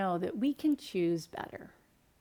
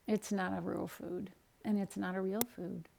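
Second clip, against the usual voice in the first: solemn little son; 2 semitones lower, 9 dB quieter than usual. Retold or imitated. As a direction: answer softly, like a child conceding. Son's solemn answer to mother.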